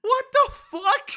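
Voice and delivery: high, loud, slow shocked shriek. Real, not acted. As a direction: stretch out a stunned shriek. Shocked by gossip story.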